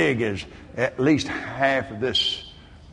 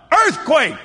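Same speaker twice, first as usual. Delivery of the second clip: sudden cry of alarm, much higher; shouted alarm; 13 semitones higher, 8 dB louder than usual. Retold or imitated. Preacher crying out to his wife as the hotel shakes, shouted alarm. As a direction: yell it high and loud. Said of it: "I said out loud".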